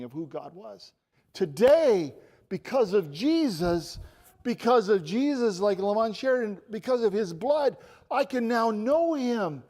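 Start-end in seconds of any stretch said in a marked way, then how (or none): 1.3-9.6 loud, higher, impassioned proclamation; full-force preaching. Real, not acted. Preacher proclaiming freedom from fear through Jesus, impassioned.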